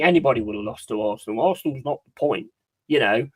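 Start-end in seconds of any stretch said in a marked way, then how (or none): none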